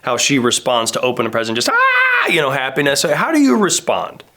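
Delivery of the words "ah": very high imitated child's squeal; exuberant squeal. Father imitating his seven-year-old opening a present, exuberant squeal. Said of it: "like an exuberant, like my seven-year-old".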